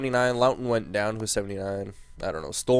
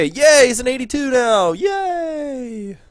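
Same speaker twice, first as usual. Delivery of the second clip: much higher and louder, squealing with joy; delighted yelp over good news; excited cheer; 13 semitones higher, 7 dB louder, slower than usual. Real, not acted. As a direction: cheer like your guy finally made it. Player's rating finally goes up.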